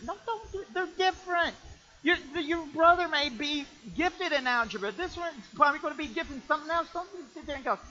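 high, pressing sermon plea; emphatic preaching. Acted. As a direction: urge the parents hard.

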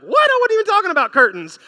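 high, loud, mock-incredulous wife; hammed-up loud, high-pitched protest; indignant outburst. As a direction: burst out in exasperated disbelief, imitating her. Acted indignant reply of mother.

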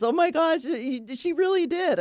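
high-pitched retold astonishment; amazed friend. Friend seeing the eagle swoop overhead, high amazed voice. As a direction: imitate a friend gasping in disbelief, voice way up.

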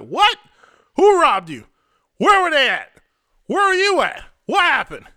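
high, loud, frantic questions from a shocked father; alarmed shouting dad. Retold or imitated. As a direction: fire the questions at him in alarm. Dad reacts to robbery news.

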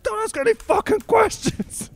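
very high, slow, strained snap; squeaky mock outburst. Tarkanian shrieking at the building owners, high and slow. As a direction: squeal it out slowly, cracking up.

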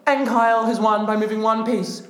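loud, high, hyped commentary; excited commentary. Acted. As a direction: call the win like a final.

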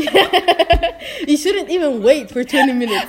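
high, loud, laughing through the words; laughing correction. Real, not acted. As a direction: blurt the correction while cracking up. Corrects own slip while laughing.

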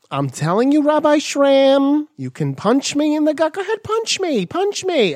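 put-on high, pushy gym girl voice; eager fitness trainer, egging him on; workout chick. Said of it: "this workout chick who's like".

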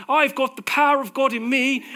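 loud, high, exaggerated British accent. Shown as a demonstration. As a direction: proclaim it in an over-the-top British accent. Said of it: "Let's do a very British accent".

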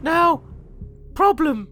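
high-pitched fussy shop lady; Mina Harker. Pretend. Charity shop worker sorting stock alone, high put-on voice. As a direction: potter about in a put-on lady's voice.

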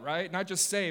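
normal talking voice

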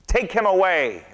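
high, loud demand; shouting. Acted. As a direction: yell at the governor. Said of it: "they shouted".

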